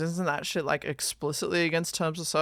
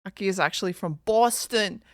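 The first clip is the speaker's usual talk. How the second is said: ordinary delivery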